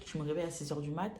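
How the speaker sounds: normal talking voice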